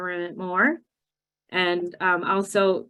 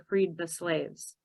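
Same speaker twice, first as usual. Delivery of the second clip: slow deliberate answer; 7 dB quieter, slower than usual. Shown as a demonstration. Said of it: "you say".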